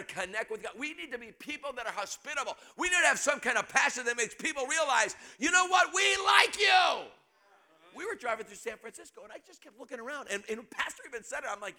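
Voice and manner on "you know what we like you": much higher and louder, last words stretched; excited shout. Acted. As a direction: shout the welcome to visitors. Preacher shouting welcome to guests.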